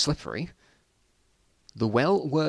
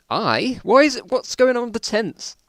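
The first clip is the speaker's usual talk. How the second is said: baffled outburst; 11 semitones higher, 6 dB louder than usual. Real, not acted. Baffled by the story's tense.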